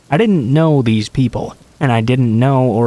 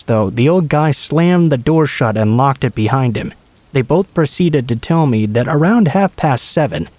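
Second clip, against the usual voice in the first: plain reading voice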